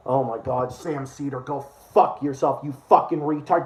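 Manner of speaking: low, muttered contempt; low, quiet disgust. Real, not acted. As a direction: mutter the insult under your breath.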